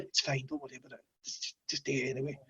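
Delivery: very soft, low, quick reassurance; easygoing dad tone. Retold or imitated. Lenient dad letting the kid off, hushed reassurance. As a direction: wave it away quietly like a dad. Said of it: "I have total dad tone".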